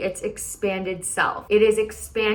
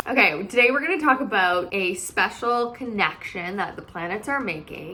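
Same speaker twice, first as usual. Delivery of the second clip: ordinary delivery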